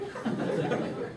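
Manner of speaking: quiet breathy chuckle; soft laugh. Real, not acted. Veteran laughing softly at the memory, breathy chuckle. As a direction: let out a soft airy laugh.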